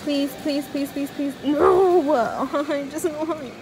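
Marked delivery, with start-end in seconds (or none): none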